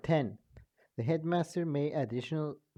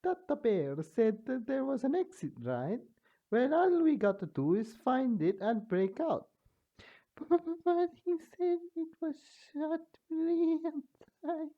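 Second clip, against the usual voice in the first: very high, keen and quick; high eager character voice; 13 semitones higher than usual. Acted. A character urging the group to find the exit, very high. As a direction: chirp it out like a plucky kid.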